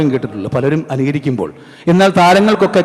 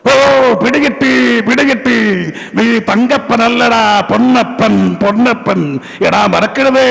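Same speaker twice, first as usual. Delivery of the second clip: put-on higher, slow, drawn-out knowing old man; Janardhanan impression; 6 semitones higher, slower than usual. Old man unmasking a news leaker, slow knowing drawl. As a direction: savour each word like a sly old uncle. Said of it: "കിടക്കുന്നൊരു ശബ്ദമുണ്ട്".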